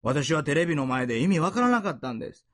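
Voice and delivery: normal talking voice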